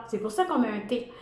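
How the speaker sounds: ordinary delivery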